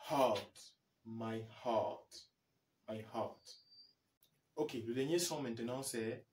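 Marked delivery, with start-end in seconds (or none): 0.1-3.6 "heart" said with a long ah and no r sound; English heart. Shown as a demonstration. A teacher drills "my heart" for students, a long ah and no r. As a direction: model "my heart" slowly, hah-t. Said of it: "Comme dans".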